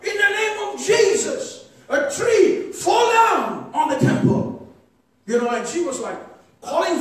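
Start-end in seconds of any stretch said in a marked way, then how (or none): 0.0-4.5 put-on a four-year-old shouting a prayer at the temple; child's shouted command. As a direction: shout it like a fierce little girl praying. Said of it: "And she's like".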